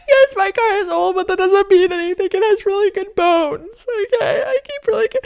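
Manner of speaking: high, loud mock-tearful whine; mock whiny crying. Defending her old car to the neighbour, hammed-up crying whine. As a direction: wail it like a wounded child defending her car.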